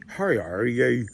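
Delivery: Northern Irish "How are you?", said "Hah rye-are yeh"; clipped Belfast-style greeting; Northern Irish accent. Retold or imitated. To Americans, how the North greets you, Northern Irish accent. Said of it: "they say things like".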